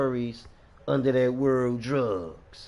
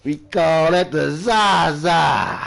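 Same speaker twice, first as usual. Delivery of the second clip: loud, very drawn-out slang name; loud playful boast; 2 semitones higher, 7 dB louder, slower than usual. Pretend. Smoker repeating his slang for viewers, loud boast. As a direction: holler it slowly with relish. Hyped naming of his weed.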